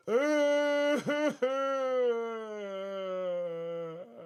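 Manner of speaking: long rising fake crying laugh; mock sob laugh. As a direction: wail it out half laughing.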